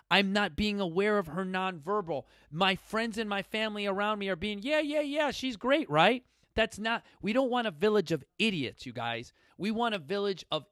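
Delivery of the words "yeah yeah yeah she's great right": high, breezy mock reassurance; mock enabling friend. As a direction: brush it off like a yes-man friend. Mocking enabling friends and family.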